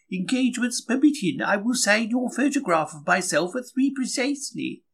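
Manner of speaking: a lightly voiced high diva; mock grand opera singer, lifted and high; grand prima donna tone, a little lofty; imitating a diva. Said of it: "Madame Tetrazzini, the old girl with the canary voice".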